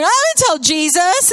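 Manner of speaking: high, loud eager chorus; imitating excited children; eager kids. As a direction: chime in like keen preschoolers. Kids all joining in.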